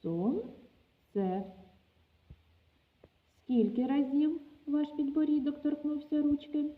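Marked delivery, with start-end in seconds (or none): 0.0-1.4 Сонце said slowly as сон-це, two beats; Сонце spoken syllable by syllable; syllabled demo word. Shown as a demonstration. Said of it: "вимовіть це слово".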